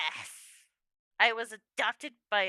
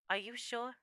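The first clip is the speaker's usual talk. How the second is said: ordinary talk, nothing marked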